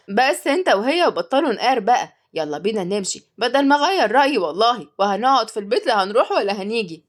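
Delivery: usual speaking voice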